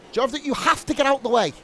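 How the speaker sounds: urgent command. Real, not acted. Commentator urges the back marker to clear the racing line, rising urgently. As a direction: hurry him out of the way. Ordering slow driver out of way.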